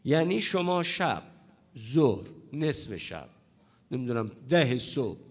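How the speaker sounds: normal talking voice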